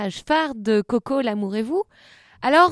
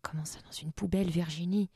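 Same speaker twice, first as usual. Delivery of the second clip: fast, much softer whispered complaint; whispered aside; 5 semitones lower, 10 dB quieter than usual. Real, not acted. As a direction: hiss it to Virginie behind the story.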